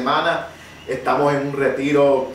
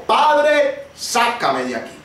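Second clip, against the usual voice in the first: high cry on Padre, then slow pleading; reliving the drowning cry to the Father; retold desperate cry; 6 semitones higher, slower than usual. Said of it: "escuché el grito".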